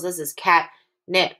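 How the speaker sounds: "catnip" split in two beats, cat then nip; pause in catnip. Shown as a demonstration. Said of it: "took a little bit of a pause in between the cat and the nip".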